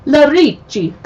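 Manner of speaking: high, loud, hammed-up Italian accent. Shown as a demonstration. Host reading chapter names, showy Italian accent. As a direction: belt the town name in showy Italian. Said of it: "my Italian accent".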